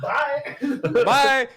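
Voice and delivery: loud, high mock farewell; blaring goodbye, laughing; real loud. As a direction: call it out far too loudly. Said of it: "real loud".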